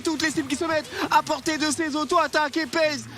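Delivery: steady excited play-by-play; esports caster. Acted. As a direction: keep the fight call going. Hyped play-by-play casting.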